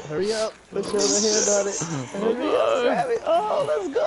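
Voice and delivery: high, loud, urgent cheering; excited shouting. Real, not acted. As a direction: yell him on, building up. Urging on the thrill ride.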